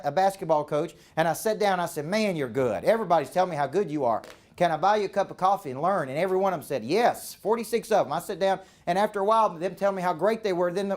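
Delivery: normal talking voice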